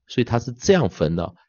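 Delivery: ordinary delivery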